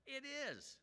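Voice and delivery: high, strained protest from Jonah; Jonah angry. Retold or imitated. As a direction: fire back like a sulking prophet. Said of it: "I'm so angry".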